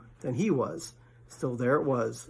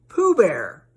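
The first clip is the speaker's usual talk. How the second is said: louder, higher bear voice; Pooh's voice; 5 semitones higher, 8 dB louder than usual. Acted. Pooh answers Rabbit, louder and higher. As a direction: state your name proudly.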